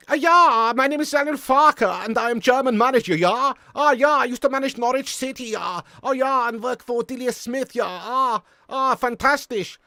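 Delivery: put-on loud, high comic German accent; best German accent. Streamer asked for his best German accent, loud hammy German manager. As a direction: play a hammed-up German football manager. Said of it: "that's basically my best German accent".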